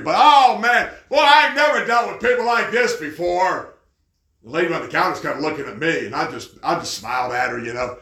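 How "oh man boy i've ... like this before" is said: loud, high mock outrage. Preacher retells mocking the lady in the waiting room, mock ranting. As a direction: parody an indignant ranter at full voice. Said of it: "I'm just mocking this lady".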